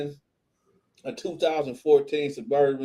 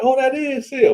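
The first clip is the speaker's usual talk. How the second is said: high, louder gasp of recognition; a wife realizing who's on the call; excited recognition; 10 semitones higher, 5 dB louder than usual. Retold or imitated. Wife spotting a celebrity, excited. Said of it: "She was like".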